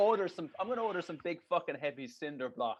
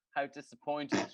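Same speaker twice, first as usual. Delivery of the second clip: ordinary talk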